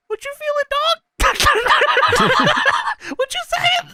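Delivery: very high, hammed-up Booker. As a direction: squeal it like a hyped-up Booker. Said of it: "And Booker says".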